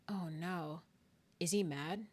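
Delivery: soft, anxious self-talk; hushed little worry; worried aside. Retold or imitated. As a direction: ask it softly, as if fretting. Worried reaction acted out.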